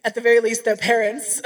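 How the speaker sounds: loud, said through laughter; laughing aside. Retold or imitated. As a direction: crack up while adding the punchline. Joking about the mom's first reaction.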